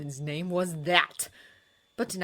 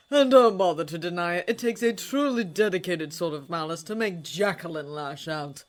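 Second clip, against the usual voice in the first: contemptuous; 2 semitones higher, 8 dB louder than usual. A narrator's reading. Holmes continues attack.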